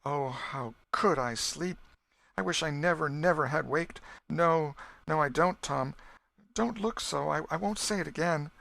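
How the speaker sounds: high, anguished cry of regret; despairing. A narrator's reading. Becky waking in the cave, despairing to Tom. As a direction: plead through rising distress. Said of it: "a groan followed it".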